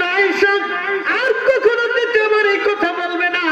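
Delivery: high, chanted sermon rendering of anger; angry rebuke. Retold or imitated. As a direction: rebuke in a high, ringing preacher's cry. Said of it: "নবীজি রাগান্বিত হয়ে গেলেন". (রাগান্বিত, উঁচু সুরে ধমক)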